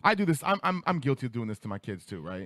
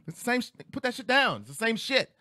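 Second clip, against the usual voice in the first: high-pitched exasperated mom; scolding mom; 10 semitones higher than usual. Retold or imitated. Mom refusing her child candy at the register, scolding. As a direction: tell the kid off, voice jumping up.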